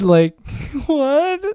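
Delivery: high, loud laughing what; laughing disbelief. Real, not acted. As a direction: laugh it off in shock. Laughing disbelief after clip.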